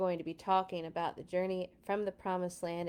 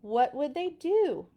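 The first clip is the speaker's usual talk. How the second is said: slow, higher, louder suspense; dramatic question; 6 semitones higher, 6 dB louder, slower than usual. A narrator's reading. Storyteller asking the children, slow and dramatic. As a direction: pause and pull them in with it.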